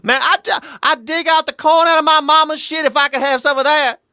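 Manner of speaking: South Carolina accent, exaggerated; Southern accent. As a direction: imitate a South Carolina buddy, hammed up. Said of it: "not all like Jacob from Newberry".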